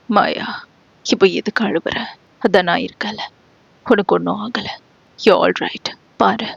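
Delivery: soft, quiet comforting; calm her down gently; soothing. Acted. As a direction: lover softly comforting his crying girl. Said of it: "சமாதானப்படுத்த முயல".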